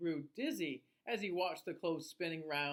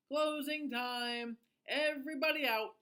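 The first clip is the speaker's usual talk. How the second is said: calling out; 6 semitones higher, 3 dB louder than usual. A narrator's reading. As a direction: call it across the laundromat, unhurried. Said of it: "the manager of the laundromat called".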